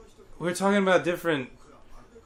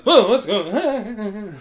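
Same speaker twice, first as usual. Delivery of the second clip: mock surprise; 5 semitones higher, 4 dB louder, much slower than usual. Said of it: "the Indian guy's like".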